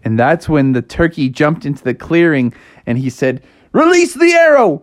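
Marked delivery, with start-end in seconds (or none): 3.7-4.8 high, loud storybook shout; dramatic high-pitched yell; battle cry. Acted. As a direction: shout the command as you let fly. Hunter cries as he fires.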